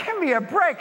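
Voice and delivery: high-pitched mock exasperation; comic frustrated squeal of protest; exasperated. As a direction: complain in hammed-up disbelief. Exasperated reaction to the message.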